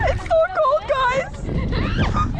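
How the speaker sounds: very high, loud cold shriek. Real, not acted. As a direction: squeal it out in the icy water. In icy lake water.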